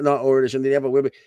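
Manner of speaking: ordinary talk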